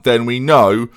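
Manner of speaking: ordinary talk, nothing marked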